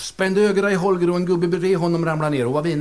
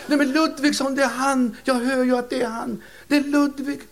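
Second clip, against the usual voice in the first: much higher, thrilled squeal of recognition; overjoyed old lady; 9 semitones higher than usual. Acted. Widow thrilled to hear her dead husband, squealing high. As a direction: gush like you've found him again.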